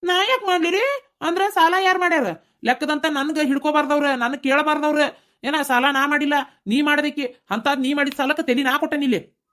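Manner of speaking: high, mocking echo of her words; husband mimics his wife's complaint, mocking and high; mocking imitation of wife. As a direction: parrot her back in a high sneering voice.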